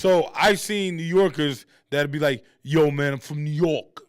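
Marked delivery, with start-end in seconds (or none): 2.6-4.0 put-on New York accent. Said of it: "Like that. That's how they talk".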